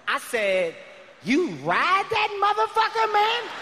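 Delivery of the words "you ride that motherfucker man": high-pitched, hammed-up disbelief; incredulous retort. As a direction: squeal it in mock astonishment. Acted incredulous punchline to the man.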